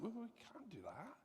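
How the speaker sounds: whisper, unvoiced and hesitant; whispered refusal. Couple quietly declining the dinner invitation, whispered. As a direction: hush a shy refusal.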